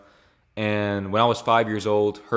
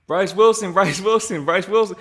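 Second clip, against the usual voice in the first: hyped-up name chant, higher and louder; excited hype; 8 semitones higher, 4 dB louder than usual. Real, not acted. Hyping up his friend Bryce by name, excited chant.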